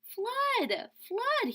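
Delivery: high, loud shout of flood; alarmed cry. Acted. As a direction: yell the warning to shift the birds. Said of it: "he cried".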